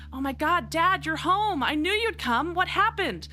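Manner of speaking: put-on high, eager girl greeting her dad; bright teen voice, thrilled he's back; excited daughter. Daughter greeting her returned father, high and overjoyed.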